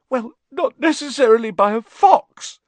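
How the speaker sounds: put-on high, loud, upset girl; distraught, high-pitched whine; tearful protest. A narrator's reading. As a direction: wail the objection. Tearful Lavender objects.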